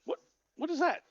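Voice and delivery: lightly voiced baffled dwarf, high pitch; a dwarf taken aback; startled dwarf. Dwarf reacts weirdly to flower.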